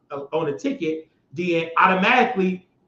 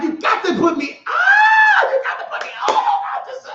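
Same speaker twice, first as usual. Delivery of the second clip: cracking into a high squeal, much louder; frustrated shriek; 5 dB louder than usual. Real, not acted. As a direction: whine it up high like you're begging to be let on air. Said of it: "I'm getting kinda frustrated".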